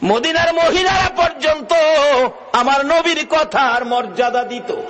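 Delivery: high preaching cry, easing down at the end; fervent proclamation. Real, not acted. Preacher praising Medina's women, high fervent cry. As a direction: proclaim it high with a long held middle. Preacher exclaims proudly to crowd.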